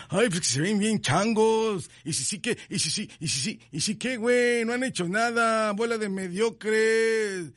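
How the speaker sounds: high, sneering mock whine; mocking the bitter fans. Host mimicking fans who scorn the celebrations. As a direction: whine it like a sour critic, put on. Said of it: "aquellos que".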